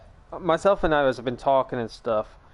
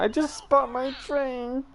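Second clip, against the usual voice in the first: imitating friend; 11 semitones higher, slower than usual. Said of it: "And she went".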